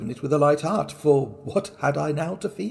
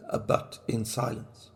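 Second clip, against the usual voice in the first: breathy whisper, almost secret; 3 semitones lower, 9 dB quieter than usual. A narrator's reading. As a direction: whisper it like a confession. Working silently at night.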